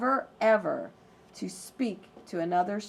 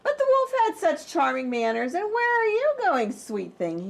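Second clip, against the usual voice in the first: higher, louder sweet-talking wolf; charming wolf; 8 semitones higher, 4 dB louder than usual. Acted. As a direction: lay on the charm to trap her. Wolf flatters the girl.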